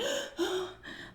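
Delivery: high dramatic gasp like a damsel in distress. Retold or imitated. Girl retelling telling her parents, hammed-up damsel gasp. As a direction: gasp as if swooning. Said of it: "like damsel in distress".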